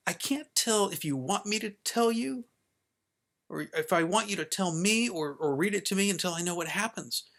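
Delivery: a little frustrated. Acted. Child muddled by dad's offer, a little frustrated. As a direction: argue it out with rising frustration. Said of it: "I got a little frustrated".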